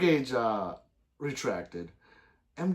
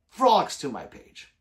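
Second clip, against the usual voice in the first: proud; 4 semitones higher, 9 dB louder, slower than usual. Real, not acted. Boasting to chat.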